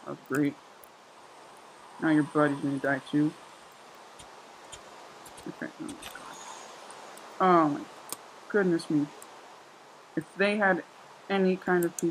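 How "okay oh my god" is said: breathless whispered disbelief; stunned whisper. Real, not acted. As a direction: whisper it in shock. Stunned after ambush.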